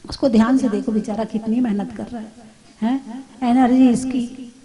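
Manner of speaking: soft, pitying murmur over a poor angry man; pitying softly. Shown as a demonstration. Teacher shows pity for someone shouting at you, softly. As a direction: say it gently, as if feeling sorry for him.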